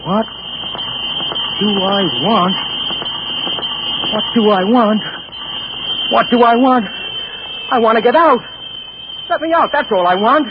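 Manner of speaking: anguished cry to get out; desperate outcry. Acted. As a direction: beg to be set free.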